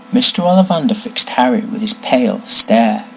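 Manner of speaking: ordinary delivery, nothing marked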